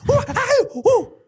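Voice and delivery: high, loud startled whoas; excited yelps. Retold or imitated. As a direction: yelp like you've just seen fire. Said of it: "I go".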